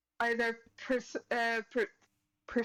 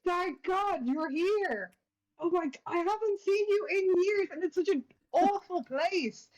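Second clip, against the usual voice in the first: overjoyed greeting; 8 semitones higher than usual. Acted. Fides hugging the tabaxi, gushing with relief. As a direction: gush with relief at finding a friend. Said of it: "and she says".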